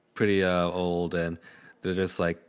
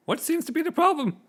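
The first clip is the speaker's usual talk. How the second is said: high, gruff-toned soldier voice, much higher; the eager captain announcing trouble; Captain Willie; faster than usual. Acted. Game master voicing Captain Willie to the party, high officious captain voice. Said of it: "He just marches towards you".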